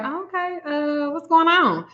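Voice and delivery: high, soft, careful voice; wary reply. Retold or imitated. Reader answers a puzzling client, high and wary. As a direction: go high and quiet, stretching the question.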